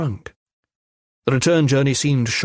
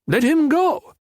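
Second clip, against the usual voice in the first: much higher, slow thug voice; shrill-voiced thug; slower than usual. A narrator's reading. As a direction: squeak it out lazily to his partner. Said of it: "Shrill voice".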